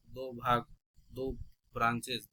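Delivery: usual speaking voice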